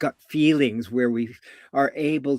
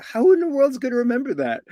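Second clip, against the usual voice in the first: high-pitched exasperated listener; overwhelmed, voice shooting up high; mock bewilderment; 11 semitones higher, 3 dB louder than usual. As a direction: protest as if lost in the directions.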